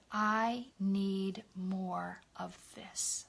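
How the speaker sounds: soft, low, very slow plea; quiet, drawn-out words, as if after crying; retold tearful plea. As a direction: recall a breakdown in a hushed voice. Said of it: "I just broke down crying".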